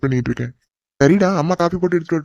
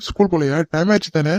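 usual speaking voice

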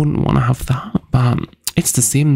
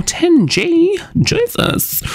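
shocked; much slower than usual. Real, not acted. Shocked at spending ten grand.

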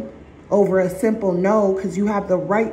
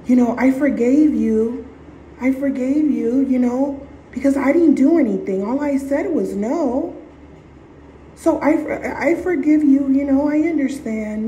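higher, earnest pleading voice; pleading forgiveness; 5 semitones higher than usual. Retold or imitated. Victim forgiving the narcissist, higher and pleading. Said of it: "here you are telling this person".

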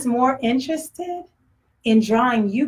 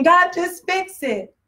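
pleading prayer; 6 semitones higher, much slower than usual. Retold or imitated. Hurting believer pleading with God.

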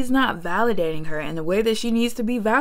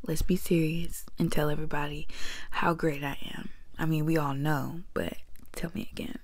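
soft, low whisper; playful whisper; 6 semitones lower, 11 dB quieter, faster than usual. Pretend. Vlogger whispers what the groom should have said, unvoiced. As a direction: whisper it like a secret demand.

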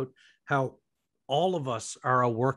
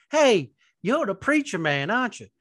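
hammed-up Southern cop; Southern state trooper; 6 semitones higher, 6 dB louder than usual. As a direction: play a Southern trooper calling out the preacher. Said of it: "he looked at me and said".

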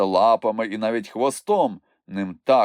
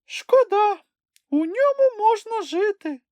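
high little creature grumbling; a small animal sulking over his tent; squeaky grumble; 4 dB louder than usual. A narrator's reading. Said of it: "буркнув він". (писклявий бурчливий голосок)

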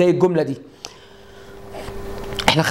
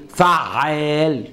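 فعال drawn out very slowly; slow pattern drill; 3 semitones lower, much slower than usual. Shown as a demonstration. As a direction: stretch فعال syllable by syllable. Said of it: "اقول ايه؟".

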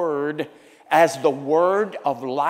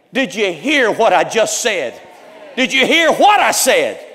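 loud, demanding question; emphatic shout; 5 semitones higher, 9 dB louder than usual. Real, not acted. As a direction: shout it at the congregation. Pastor challenging congregation.

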